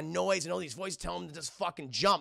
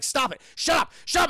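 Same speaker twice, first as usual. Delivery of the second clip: loud, high, frantic protest; acted panicked outburst; tormented shouting; 10 semitones higher, 8 dB louder than usual. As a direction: yell to drive the voices out. Tormented man shouts at voices.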